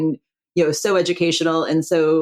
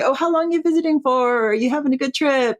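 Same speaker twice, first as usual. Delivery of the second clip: friendly shopkeeper; 9 semitones higher than usual. Retold or imitated. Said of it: "they say".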